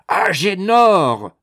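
loud, slow, hoarse yell; hoarse shout. A narrator's reading. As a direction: bellow his name to wake him. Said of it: "s'enrouait Thérèse".

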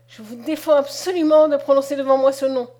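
loud, shaking with anger; trembling with anger. A narrator's reading. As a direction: forbid it in a furious voice. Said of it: "d'une voix tremblante de colère".

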